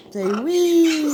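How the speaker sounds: high, drawn-out "wee"; high playful prompt. Shown as a demonstration. Owner teaches parrot to say wee, high and playful. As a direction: squeal "wee" for a pet to copy.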